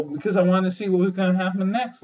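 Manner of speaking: normal talking voice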